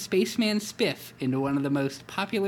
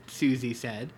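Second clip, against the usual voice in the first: ordinary delivery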